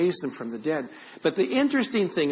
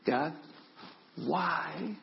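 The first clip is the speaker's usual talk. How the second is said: soft, slow, drawn-out questioning; hushed plea; 3 semitones higher, 6 dB quieter, slower than usual. Retold or imitated. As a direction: plead quietly, worn out after the outburst. Soft plea to God after outburst.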